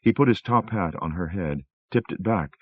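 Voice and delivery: ordinary delivery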